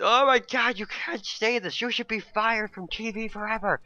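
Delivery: high, drawn-out offended whine; mock outrage. Mimicking people offended by a TV remark, mock outraged. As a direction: imitate an overdramatic complainer, pitched right up.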